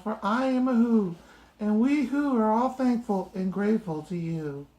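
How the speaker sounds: a tiny voice from a dust speck; squeaky little mayor; tiny Who voice. Acted.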